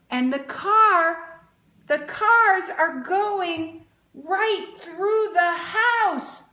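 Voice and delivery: high, slow, alarmed storyteller voice; mock alarm. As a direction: play up the shock for the kids. Reading picture book to children.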